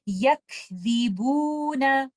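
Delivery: यकज़िबूना slow, breathy काफ, no nasal; correct recitation. Shown as a demonstration. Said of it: "हम इसको इस तरह से पढ़ेंगे". (यकज़िबूना सही तरीका)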